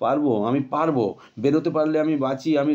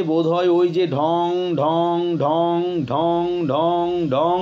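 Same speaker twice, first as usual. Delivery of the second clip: slow ding ding of a bell; imitated gong; 4 semitones higher, much slower than usual. Boy imitating the watchman's gong, slow tolling. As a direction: toll each stroke slowly.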